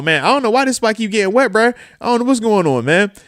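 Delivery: playing the baffled buddy washing his car in the rain; mock puzzled friend. Imitating his friend at the car in the rain, puzzled and fast. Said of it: "I'm talking about".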